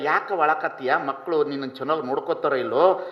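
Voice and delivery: fast, low questioning; asking the weeping old woman quickly and low; quick concerned questions. Retold or imitated. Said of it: "ನಾ ಕೇಳ್ದೆ".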